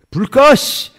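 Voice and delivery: loud, high snap of turn off the light; a flash of loud, high temper; angry shout. Retold or imitated. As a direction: bark it, suddenly irritated. Snapping again after apology.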